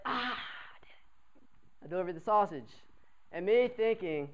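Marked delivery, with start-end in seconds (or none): 0.0-1.8 slow, breathy whispered victory; triumphant whisper. Retold or imitated. Knob after hitting the roommate with sausage, slow whisper. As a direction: whisper it slowly, amazed at yourself. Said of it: "I was like".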